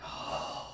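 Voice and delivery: long breathy exhale; soft, wordless sigh of dismay; heavy sigh. Real, not acted. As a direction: let out a sigh at the gore. Reader reacts to gory scene.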